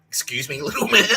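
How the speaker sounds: mock condescension, laughing. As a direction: talk down to the little man with a laugh. Mocking a shorter man.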